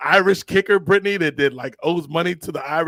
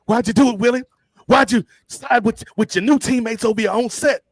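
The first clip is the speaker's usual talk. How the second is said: mock coach quote; 7 semitones higher, 4 dB louder than usual. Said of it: "and he's like".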